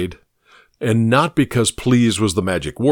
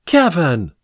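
a sharp, higher outcry of a name; cried out; 7 semitones higher, 3 dB louder than usual. A narrator's reading. Evelyn scolding her fiancé Kevin, sharp higher outcry. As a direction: call him out, shocked and scolding. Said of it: "Evelyn cried".

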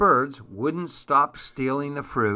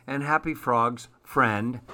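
plain reading voice

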